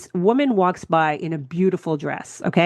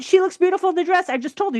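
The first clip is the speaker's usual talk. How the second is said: put-on boyfriend's defense; 11 semitones higher, faster than usual. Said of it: "He said".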